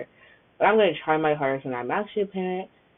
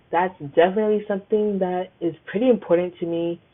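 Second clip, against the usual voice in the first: usual speaking voice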